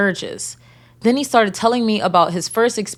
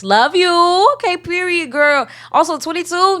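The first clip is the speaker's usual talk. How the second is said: hyped approval; 10 semitones higher, 4 dB louder than usual. Real, not acted. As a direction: cheer the listener on. Hyped reaction to listener.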